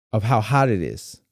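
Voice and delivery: normal talking voice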